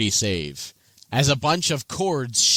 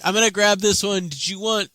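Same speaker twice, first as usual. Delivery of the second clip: higher, playful imitation of the player; mock casual offer; 7 semitones higher than usual. Game master riffs on player grabbing goblins, mock casual offer. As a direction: tease with a chirpy coffee-order offer. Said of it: "I like that you said that, like".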